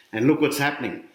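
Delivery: ordinary delivery, nothing marked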